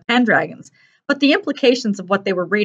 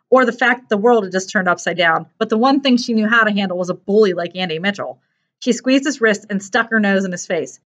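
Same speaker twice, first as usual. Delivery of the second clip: plain reading voice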